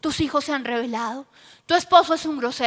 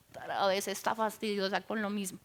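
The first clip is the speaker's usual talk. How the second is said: low, very soft aside; mock annoyed grumble; 7 semitones lower, 14 dB quieter than usual. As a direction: grumble under your breath, imitating weary annoyance. Negated reply of an annoyed God.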